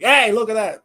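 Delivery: retold yell. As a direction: imitate yelling through a wall to someone. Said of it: "We're just screaming at each other".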